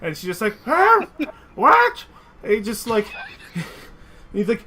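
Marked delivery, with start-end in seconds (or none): 0.7-2.0 put-on very high, confused old woman; old dwarf grandma, confused; old auntie. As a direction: squeak it out like a deaf granny. Deaf old aunt reacts.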